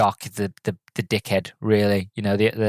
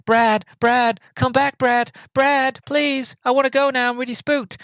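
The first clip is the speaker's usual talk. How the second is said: high, put-on pleading girlish voice; scared girl calling; 14 semitones higher than usual. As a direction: beg your boyfriend to come back, hammed up. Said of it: "I'm just gonna call him Brad".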